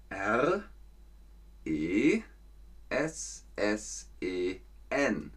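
slow letter-by-letter dictation; careful classroom spelling, letter by letter. Shown as a demonstration. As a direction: spell each letter for pupils to write. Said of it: "könnt ihr das Wort schreiben".

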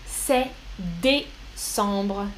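c'est décembre drawn out very slowly and softly; slow and clear. Shown as a demonstration. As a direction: spell it out slowly for the class. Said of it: "Look at the spelling here". (très lentement et doucement)

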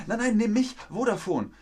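a provider begging a customer to choose it, high and pleading; eager provider. Pretend. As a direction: beg to be picked, high and eager.

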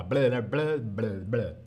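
garbled tongue-tripping "bla bla"; tongue-tied babble. Shown as a demonstration. Teacher showing how tongue twisters tangle you, tongue-tied babble. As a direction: let the tongue trip over itself.

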